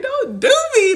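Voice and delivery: high, loud giggly objection; playful mock pleading; laughing protest. Acted laughing protest to the grandma voice.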